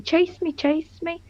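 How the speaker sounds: put-on high, soft teasing chant of a child; playful playground kid. Guest likening her teasing to a playground crush, playful kid voice. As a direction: lightly play a kid begging to be chased. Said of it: "when a kid in the playground".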